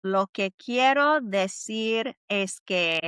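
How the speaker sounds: slow, careful model phrase; Spanish phrase spoken slowly for learners; slow clear demo. Shown as a demonstration. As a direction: lay each word out slowly. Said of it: "you say it like this".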